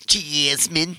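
"Jasmine" drawn out in a put-on accent; daughter's accent. Dad repeating his daughter's word, put-on accent. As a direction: mimic a child's accented "Jasmine". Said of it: "Where did you get this accent from?".

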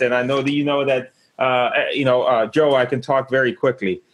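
normal talking voice

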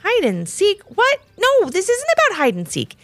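high, incredulous protest; shouting. Acted. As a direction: protest as an excited kid who's been misunderstood. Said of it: "shouted Levi as he opened".

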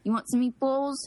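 South Philly Italian-American accent. Shown as a demonstration. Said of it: "stereotypical, like, Italian".

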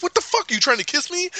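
very high, incredulous squawk; mock shocked disbelief. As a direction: act appalled by an attempted kiss. Acting out awkward fight quarrel.

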